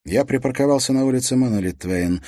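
lightly acted breathless rush; out of breath. A narrator's reading. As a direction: hurry it out as if short of breath. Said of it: "задыхаясь".

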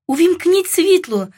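high, urgent cry; shouted demand. A narrator's reading. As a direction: cry out an order, pitch jumping up. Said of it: "закричала я". (вигук-вимога високим голосом)

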